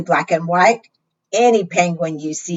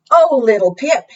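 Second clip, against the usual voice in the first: tender mother; 5 semitones higher, 4 dB louder than usual. A narrator's reading. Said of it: "said Mama".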